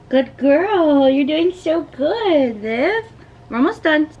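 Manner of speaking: high, slow praise for a pet; talking to a dog. Real, not acted. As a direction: coo at her like a puppy. Praising dog during nail trim.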